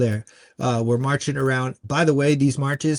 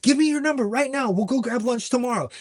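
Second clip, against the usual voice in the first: much higher-pitched imitation of an eager woman; eager insistence; 10 semitones higher than usual. Girl asking a survivor for her number, eager. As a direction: mimic a girl jumping in to befriend her. Eager new friend offers support.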